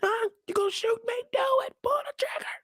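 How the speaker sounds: high taunting voice sinking to a whisper; woman daring the dealers, in a high mock voice; defiant woman. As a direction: dare them to shoot, high and quiet. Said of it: "She said".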